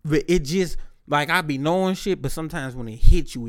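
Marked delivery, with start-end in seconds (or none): none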